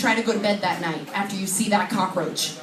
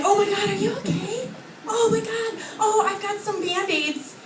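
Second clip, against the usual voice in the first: mock concerned neighbor; 8 semitones higher, 3 dB quieter than usual. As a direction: fuss over someone in a high voice. Acting concerned Eugene neighbors.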